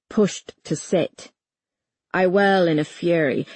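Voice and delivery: usual speaking voice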